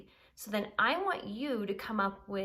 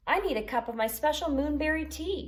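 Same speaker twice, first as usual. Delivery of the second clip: Unicorn's request; 7 semitones higher, 5 dB louder than usual. A narrator's reading. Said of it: "he said".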